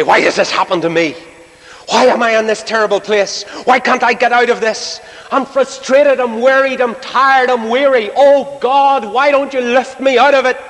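high, mounting plea to God; acted desperate complaint. Troubled believer asking God for a way out, high pleading complaint. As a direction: pile up the complaints, pitched high and insistent.

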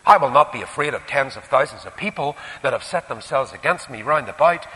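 usual speaking voice

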